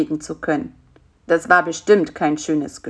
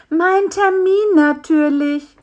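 high, slow, emphatic fly voice; small, high-pitched, put-out insect; indignant little fly; 10 semitones higher, 5 dB louder, slower than usual. A narrator's reading. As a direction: state the obvious huffily in a high voice.

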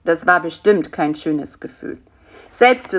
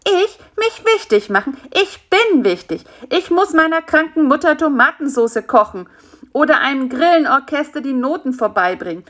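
high, self-important fly protesting; huffy, high-pitched insect tirade; indignant little fly; 8 semitones higher, 4 dB louder than usual. A narrator's reading. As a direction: rattle off grievances in a small high voice.

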